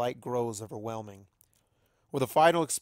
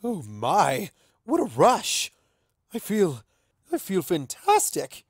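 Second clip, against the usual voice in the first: higher, louder, giddy with power; exhilarated; 6 semitones higher, 7 dB louder, slower than usual. Acted. Luna after her transformation, thrilled and louder. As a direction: revel in the rush. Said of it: "She laughs".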